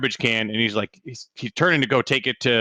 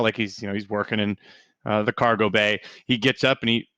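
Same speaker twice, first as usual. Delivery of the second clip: normal talking voice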